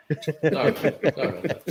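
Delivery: laughing apology. Real, not acted. Laughing apology after shouting.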